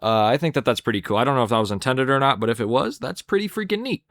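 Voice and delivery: usual speaking voice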